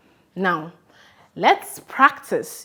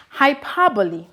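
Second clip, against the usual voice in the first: normal talking voice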